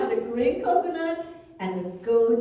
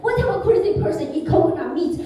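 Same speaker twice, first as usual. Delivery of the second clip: louder, indignant outburst; mock yelling; 4 semitones higher, 7 dB louder than usual. Vendor yells at her for eating coconut meat. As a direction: hurl it at her like an insult. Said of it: "this guy is yelling at me again".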